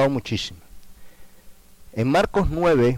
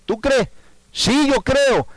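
very high, loud eager answer; 1 excited cry; 6 dB louder, slower than usual. Acted. The father answering Jesus, a high loud eager cry. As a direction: blurt out belief in a high shout.

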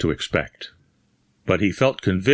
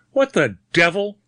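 high, startled exclamation; taken aback and indignant; surprised growl; 8 semitones higher than usual. A narrator's reading. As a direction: blurt it in gruff surprise. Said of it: "growled the surprised voice".

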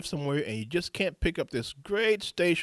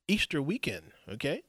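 ordinary talk, nothing marked

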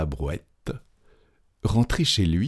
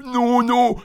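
very high frantic protest; terrified cry; 4 dB louder than usual. A narrator's reading. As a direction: cry out for mercy. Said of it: "cria le chantre". (cri de terreur aigu)

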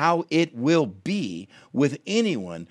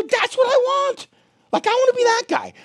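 excited wanting; 6 dB louder than usual. Retold or imitated. Preacher voicing his own greed.

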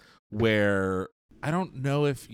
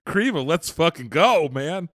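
hyped excitement; 6 semitones higher than usual. Pretend. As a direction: pump it up like a fanboy. Mocking co-host's enthusiasm.

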